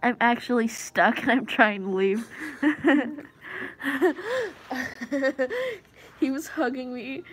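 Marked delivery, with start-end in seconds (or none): 2.3-5.8 loud helpless laughter; long laugh. Real, not acted. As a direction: laugh uncontrollably.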